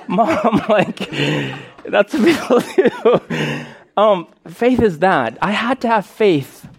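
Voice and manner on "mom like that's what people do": laughing reply. Retold or imitated. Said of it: "I'm like".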